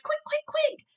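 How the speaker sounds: high loud hurry; urgent excited cry. A narrator's reading. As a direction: rush her, thrilled. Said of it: "he cried".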